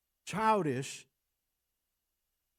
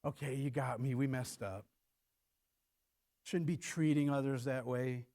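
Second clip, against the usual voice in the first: meek imagined confession; 5 semitones lower, 5 dB quieter than usual. Pretend. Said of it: "I think Pharaoh's like".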